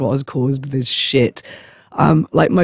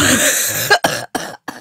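high wheezing laugh; helpless laughter; 11 semitones higher than usual. Real, not acted. Singer laughs at their own impression, helpless laughter. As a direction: crack up, trailing away. Cracks up after singing.

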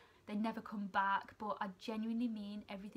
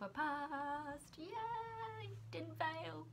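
much higher, sung celebration; sing-song yay; 7 semitones higher, much slower than usual. Real, not acted. Student announcing she passed her placement, singing it out. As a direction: lilt it like a little victory tune.

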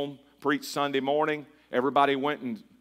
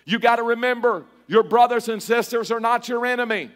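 higher and louder, rhythmic preaching cadence; preaching at full force; 8 semitones higher, 6 dB louder than usual. Acted. Pastor rallying the congregation over organ, full-force preaching. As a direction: build the sermon to its peak.

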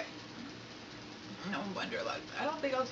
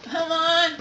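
loud, high, pained from chili heat; gasping through a burning mouth; mouth burning from spicy food; 6 semitones higher, 11 dB louder, much slower than usual. Real, not acted. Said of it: "My lips are burning".

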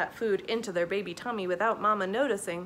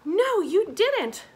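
high, loud, drawn-out chorus reply; crying out; 10 semitones higher, 8 dB louder, slower than usual. A narrator's reading. Sellers answering Mama, crying out. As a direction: call it out emphatically. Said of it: "cry Missus Ade".

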